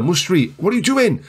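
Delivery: high-pitched exasperated outcry; alarmed. Real, not acted. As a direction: call out in alarm at your player. Alarmed at own player's play.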